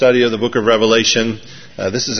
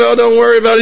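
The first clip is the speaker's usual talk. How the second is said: put-on old gal reassuring; 11 semitones higher, 5 dB louder, much faster than usual. Old woman acted in comic story.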